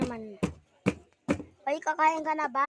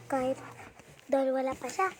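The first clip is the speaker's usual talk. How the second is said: ordinary talk, nothing marked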